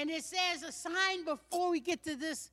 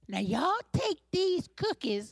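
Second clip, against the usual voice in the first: Southern country lady; 3 semitones lower than usual. Acted.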